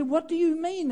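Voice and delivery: asking back in hurt disbelief; baffled young man's question. Retold or imitated. Young [name] asks the elder what he means, baffled. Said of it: "and I said".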